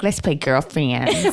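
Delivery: low, slow mock baby talk; imitating mom's baby voice. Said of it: "she talked in this baby voice".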